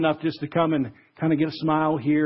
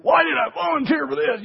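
much higher, exasperated whine; exasperated groan; 11 semitones higher than usual. Pretend. Host vacuuming before guests, exasperated high whine. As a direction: moan it like a frazzled host regretting the offer.

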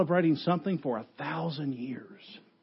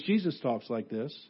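low, soft, matter-of-fact reply; quiet answer; 4 semitones lower, 8 dB quieter than usual. Real, not acted. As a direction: drop the voice right down to answer. Quiet answer to shouted question.

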